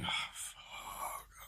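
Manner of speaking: breathless whispered dread; whispered curse. Retold or imitated. Traveler hears dog is coming, whispered curse. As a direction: whisper it like you're caught.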